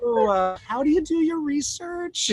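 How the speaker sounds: high, slow, worried mom voice; imitating mom. Son imitates mom asking about his serial killer research, high and slow. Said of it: "my mom would literally call me up and be like".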